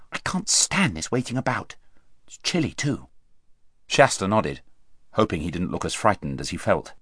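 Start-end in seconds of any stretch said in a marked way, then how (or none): 0.1-3.1 soft, restless complaint; quiet fretting while waiting for battle; hushed impatience. A narrator's reading. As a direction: grumble under the breath. Corin fretting quietly before battle.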